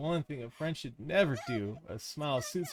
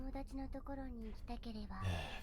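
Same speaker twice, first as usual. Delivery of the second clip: eerie sigh; 12 semitones higher, 11 dB quieter than usual. Acted. Mysterious girl appears.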